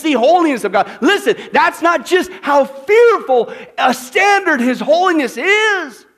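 loud, high pulpit hammering; fiery preacher pressing the congregation; full-force preaching. Acted. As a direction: drive the argument home at a shout.

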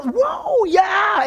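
shouting with enthusiasm; excited shout. Real, not acted. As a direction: burst out in delight. Exclaiming loudly to the congregation.

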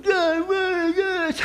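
high, soft, strained mock wail; hammed-up aggrieved plea; mock anger at God. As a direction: imitate someone whining up at God. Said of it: "get mad at God".

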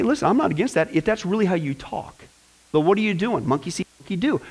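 normal talking voice

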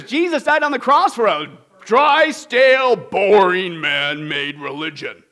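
loud, dragging sarcastic list; mock wrong answer. Shown as a demonstration. Preacher voicing the wrong answer, mocking drawl. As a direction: sneer out the dull religion. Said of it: "Wrong answer".

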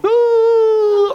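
high, loud startled ooh; wife's shriek. Retold or imitated. Wife seeing the hurt dog, shrieking ooh. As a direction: let out a sharp high cry of alarm. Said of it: "Brandy's going".

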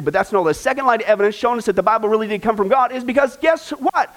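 normal talking voice

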